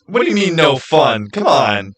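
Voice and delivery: usual speaking voice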